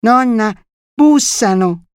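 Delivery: put-on high excited little boy's cry; excited shrill child. A narrator's reading. Little boy telling grandmother someone is knocking, excited cry. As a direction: call out excitedly as a small boy. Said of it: "eccitato, strillò".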